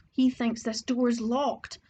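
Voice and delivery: usual speaking voice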